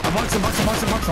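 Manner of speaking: high, loud urgent callout mid-fight; excited callout. Real, not acted. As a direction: shout it out fast as the fight kicks off. Calling out during a fight.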